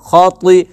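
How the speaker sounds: ती with a heavy, emphatic Arabic t; खती with thick, back-of-tongue ती; full-mouthed t. Shown as a demonstration. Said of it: "तो पुर पढ़ा जाता है".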